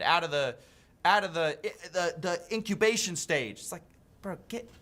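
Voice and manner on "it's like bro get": breathy whispered aside; a hushed disbelieving bro. Real, not acted.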